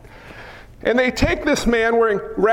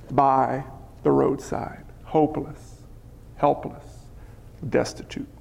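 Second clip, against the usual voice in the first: hushed, grave; 9 semitones lower, 8 dB quieter than usual. A narrator's reading. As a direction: let each word sink lower and softer. Preacher lets the scene sink low.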